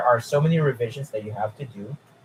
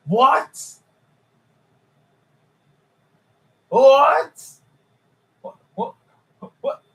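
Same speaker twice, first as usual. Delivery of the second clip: loud, much higher rising what; can't believe your eyes; disbelieving what; 12 semitones higher, 6 dB louder, slower than usual. Real, not acted. Reacting to the track record.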